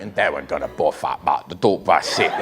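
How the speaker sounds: put-on thick, mumbled Cockney accent. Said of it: "impression of an accent".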